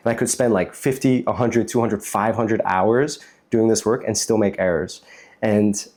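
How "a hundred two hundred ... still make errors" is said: ordinary delivery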